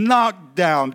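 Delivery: ordinary delivery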